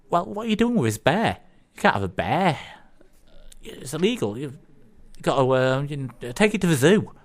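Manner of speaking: high-pitched, flustered telling-off; lightly voiced officer, halting as he finds the order; objecting policeman. Policeman telling a driver off about his bear, flustered objection. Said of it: "he says".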